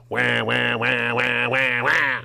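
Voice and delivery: whiny fake wah wah; mock baby crying. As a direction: sneer it like a fake cry.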